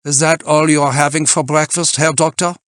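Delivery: put-on loud, high German-accented question; German climber checking up on him; critical German climber. A narrator's reading. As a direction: challenge him in an imperious German voice. Freitag's German character voice, challenging.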